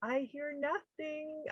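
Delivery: tiny high voice of a baffled listener; imitated puzzled listeners. Host imitating listeners of a screen-based episode, mock bewilderment. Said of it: "our listeners were like".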